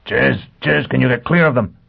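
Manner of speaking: urgent call. Acted. Foyle calling urgently on radio.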